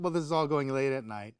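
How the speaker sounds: ordinary talk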